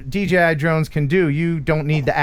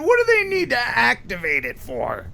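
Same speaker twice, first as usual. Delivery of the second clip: mock conspiracy; 7 semitones higher than usual. Mock conspiracy theory rant.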